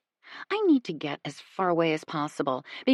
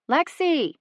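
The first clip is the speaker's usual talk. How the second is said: higher pitched call into the woods; yelling a name; 9 semitones higher, 3 dB louder than usual. A narrator's reading. Alexis yells again searching for Lexi, calling out. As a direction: yell the name out into the dark. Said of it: "she yells again".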